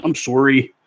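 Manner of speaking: Canadian "sorry", rounded SOR-ee; Canadian accent. Shown as a demonstration. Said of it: "There's the Canadian accent".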